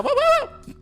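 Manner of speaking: very high, loud "woo" bursts; mock cheering whoops. Host mimics girls naming colleges, high mock whoops. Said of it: "I was like".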